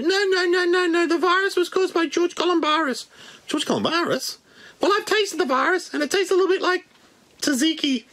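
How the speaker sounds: very high squeaky puppet bat; Wuhan bat voice. Pretend. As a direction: squeak out hellos like a tiny bat. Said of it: "The Wuhan bat".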